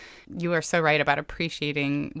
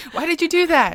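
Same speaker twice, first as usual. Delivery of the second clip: much higher, louder self-scolding; harsh inner critic; 12 semitones higher, 7 dB louder than usual. Retold or imitated. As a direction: scold yourself in disbelief.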